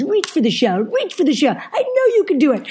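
bossy little coach in a put-on Eastern European accent, pitched high; high, demanding, half-managed Eastern European accent; mimicked Eastern European accent. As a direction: attempt a strict Eastern European trainer, voice climbing. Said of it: "I can't do the accent".

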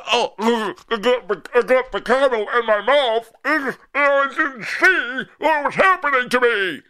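mocking a flustered opponent; sneering imitation. Mocking an angry listener.

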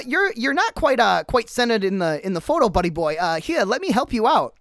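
a hint of the cameraman's nervous chatter; sly cameraman. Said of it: "he comes out from behind his sheet and he says".

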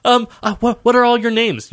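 high, thrilled gushing; squeal, then stumble over the question; giddy high-pitched fan; starstruck fan. Acted. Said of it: "such a big fan".

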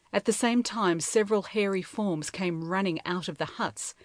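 usual speaking voice

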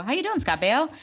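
high-pitched, self-conscious hello; awkward greeting. Retold or imitated. Reenacting an awkward hello in a waiting room, awkward greeting. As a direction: greet a stranger with forced, lifted pitch. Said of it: "I said his first and last name like that".

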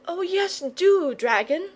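put-on bright high delighted boy; boy cheering the dragon on, delighted. A narrator's reading. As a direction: jump in with a boy's glee. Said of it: "said the Boy delightedly".

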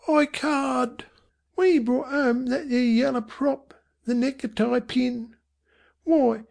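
put-on high-pitched young Cockney boy; defiant knowing boy. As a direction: argue back as a child, pitched high. Voices knowing boy arguing back.

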